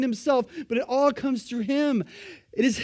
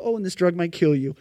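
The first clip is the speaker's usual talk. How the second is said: infomercial fine print; 5 semitones lower than usual. Retold or imitated. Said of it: "like the end of an infomercial".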